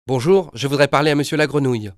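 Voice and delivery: put-on boy hiding a laugh as he asks; cheeky prank phone request; prank caller. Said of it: "me moquer de mon correspondant".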